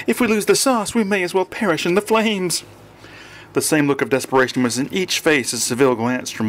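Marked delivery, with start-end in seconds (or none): 0.1-2.6 high, desperate daughter's plea; implore, pitched high throughout; begging. A narrator's reading. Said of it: "one of the daughters begged".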